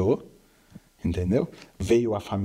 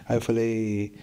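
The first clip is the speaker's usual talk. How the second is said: ordinary talk, nothing marked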